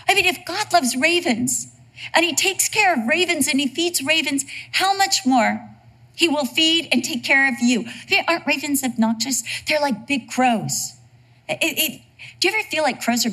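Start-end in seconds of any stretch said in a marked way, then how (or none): none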